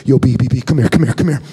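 low, loud buddy calling out; hammed-up pal beckoning from the back; imitating friend Chris. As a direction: call your friend over, low and loud. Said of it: "he's like".

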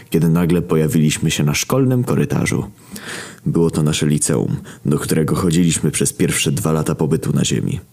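ordinary delivery, nothing marked

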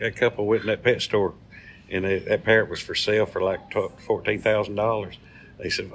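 usual speaking voice

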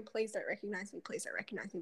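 mock-panicked whisper to herself; hushed plea. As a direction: breathe it as a desperate whispered prayer not to be seen. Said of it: "I was just sitting there going".

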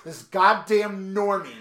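hammed-up scornful announcement; mock indignation. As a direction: stretch out every word in mock outrage. Mock indignant echo about girlfriend.

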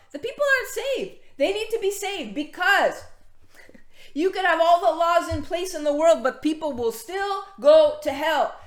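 high, loud urgent appeal; impassioned preaching. Real, not acted. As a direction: cry it out to the church that souls are lost.

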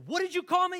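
a Texan mishearing the French word; indignant shout. Retold or imitated. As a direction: yell it like insulted. Offended mishearing.

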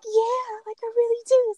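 high-pitched mock whine; tearful insistence. Retelling begging friends for the hospital, mock tearful. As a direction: plead it as if about to cry. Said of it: "So I start crying or whatever".

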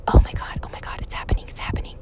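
whispered, thrilled anticipation; excited whisper. Retold or imitated. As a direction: whisper it, barely containing excitement. Daughter's class is next.

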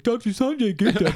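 mocking disbelief. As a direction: sneer the title back at him. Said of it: "And Michael Moore's like".

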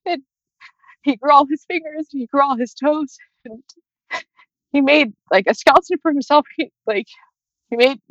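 real tears, voice high and breaking as she talks; crying while talking. Real, not acted. Mother grieving her unborn son, talking through real tears. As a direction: push the words out through crying.

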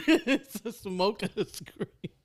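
slow, high, laughing "smoker screen"; laughing echo. Retold or imitated. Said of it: "It sounded better like that".

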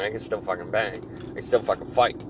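ordinary delivery

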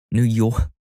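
mock New York Italian accent; laugh, then say it in a bad Italian-American accent; joking Italian-American New York accent; shitty Italian accent. Shown as a demonstration. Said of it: "my shitty Italian accent".

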